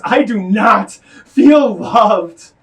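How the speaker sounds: loud, slow, drawn-out cry; slow anguished shout. Acted. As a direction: shout it out, each word slow. Climax of poem, slow outcry.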